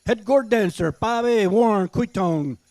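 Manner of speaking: powwow emcee. Acted. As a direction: call the names out to the crowd. Emcee calls gourd dancer.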